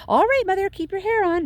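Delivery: high, loud, bratty shout back at her mother. A narrator's reading. Violet shouts back at her nagging mother, high and bratty. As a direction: yell it like a sulky girl told off. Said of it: "Miss Beauregard shouted".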